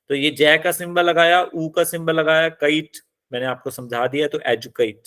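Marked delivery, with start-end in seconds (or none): none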